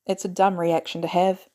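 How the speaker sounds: ordinary delivery, nothing marked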